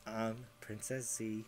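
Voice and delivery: slow hushed whisper in her ear. Real, not acted. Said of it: "I'm gonna whisper in your ear".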